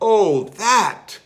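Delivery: high, loud dismissive cry. Acted. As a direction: brush it off loudly. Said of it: "cried Bear".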